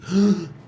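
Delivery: loud sudden inhaled gasp; sharp gasp. Retold or imitated. Man reviving in the ICU, one loud gasp.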